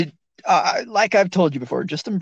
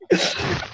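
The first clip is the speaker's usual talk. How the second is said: high laugh. Real, not acted. High laugh at Shrek joke.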